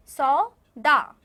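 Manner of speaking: सौदा very slow and loud, split in two; teacher breaking सौदा into long syllables. Shown as a demonstration. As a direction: say सौ, pause, then दा, both held. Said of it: "आप मेरे पीछे बोलेंगे".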